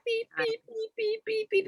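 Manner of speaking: very high squeaky beeps like a plush toy. Retold or imitated. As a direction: squeak each beep like a toy's nose. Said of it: "the sound that the little nose makes".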